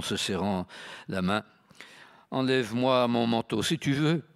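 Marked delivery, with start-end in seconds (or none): 2.3-4.2 higher, sing-song request; a lightly voiced count; lilting count voice. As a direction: ask lightly, mock polite. Count orders valet.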